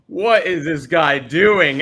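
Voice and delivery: put-on loud, slow disbelief at the guy's accent; what on earth is he doing, said slowly; baffled. Baffled reaction to thick accent.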